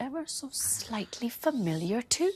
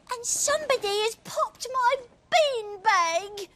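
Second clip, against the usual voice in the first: put-on child voice. Little person voiced.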